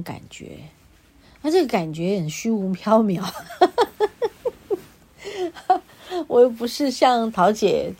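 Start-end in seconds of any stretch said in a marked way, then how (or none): 3.3-4.8 high, staccato giggle. Real, not acted. Host amused at her own vague feeling, high giggle. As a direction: burst into a high run of giggles.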